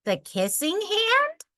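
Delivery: put-on high, wondering child question; a small child amazed; curious child. A narrator's reading. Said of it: "asked Chester".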